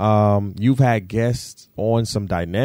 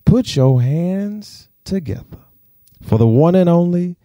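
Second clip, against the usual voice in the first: ringmaster announcing the star; grand entrance announcement; 9 semitones higher, 5 dB louder, slower than usual. Acted. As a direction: stretch the name out, building higher and louder. Announcing the guest onto stage.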